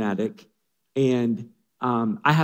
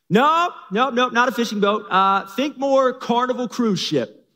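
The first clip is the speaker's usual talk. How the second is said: much higher and louder, grand and slow at the end; excited correction; 9 semitones higher, 5 dB louder than usual. Acted. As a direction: dream big about a cruise ship. Noah corrects his wife.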